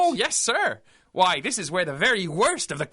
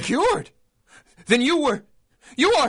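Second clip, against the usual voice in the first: alarmed; 6 semitones higher, 6 dB louder than usual. Acted. Orderly realising danger.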